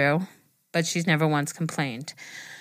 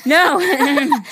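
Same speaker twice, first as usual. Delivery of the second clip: laughing no; 9 semitones higher, 8 dB louder than usual. Real, not acted. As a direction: burst of laughter. Denies crying, laughing.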